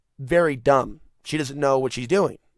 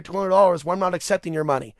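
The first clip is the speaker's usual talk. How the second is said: normal talking voice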